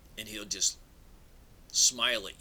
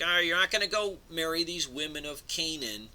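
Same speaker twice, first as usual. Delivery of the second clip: higher, louder commanding patriarch; a hint of a mob boss giving orders; bossy elder ordering; 6 semitones higher, 5 dB louder than usual. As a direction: lay down the law to the young man. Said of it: "this is a mob type of mentality".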